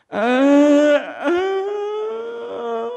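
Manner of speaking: high, drawn-out mock wail; imitated crying. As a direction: fake a long sobbing "ah". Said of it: "nangis gitu". (tiruan tangis melengking)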